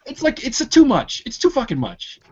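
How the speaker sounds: high, fed-up complaint; exasperated. Real, not acted. Friend complaining to his podcast mates about a joking cashier, fed up and pitched high.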